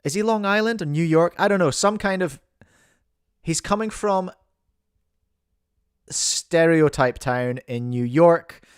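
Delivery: ordinary talk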